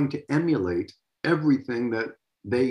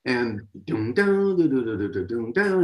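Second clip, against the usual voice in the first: vocal drum beat. Retold or imitated. Drummer imitating his part for the host, sung drum syllables. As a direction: sing the drum groove as doom doo syllables.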